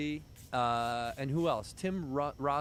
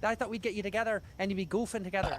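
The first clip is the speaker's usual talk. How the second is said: imitating the Irishman; 6 semitones higher, faster than usual. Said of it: "the Irishman goes".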